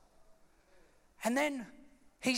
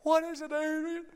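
higher, hammed-up boxer voice; mock-anguished question; Rocky; 4 semitones higher than usual. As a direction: plead like a tearful fighter. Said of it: "He's crying".

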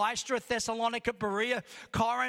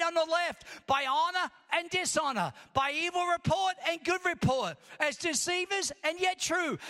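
building preaching; 4 semitones higher than usual. Acted. Preacher reading Paul's list to the church, building to a shout. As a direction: preach it, building to full force. Preaching scripture, rising to full force.

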